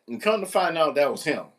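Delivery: ordinary talk, nothing marked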